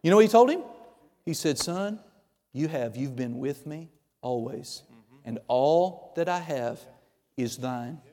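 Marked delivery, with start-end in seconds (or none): 1.6-8.0 soft, low fatherly reassurance, unhurried; tender reassurance. Retold or imitated. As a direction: gently reassure a sulking son, voice dropped. Father tenderly reassures older son.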